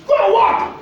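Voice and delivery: high cry. Real, not acted. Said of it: "repeated command, preacher emphasis".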